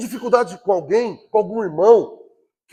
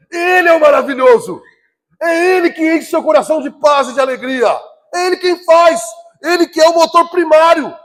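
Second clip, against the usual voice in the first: loud, high, emphatic preaching; pregação inflamada; 7 semitones higher, 8 dB louder than usual. Real, not acted. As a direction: preach it at full force, stretching the key words. (pregação forte, alta e enfática)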